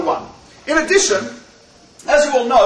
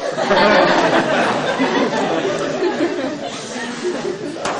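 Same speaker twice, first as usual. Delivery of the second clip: laugh. Real, not acted. Laughs at own joke.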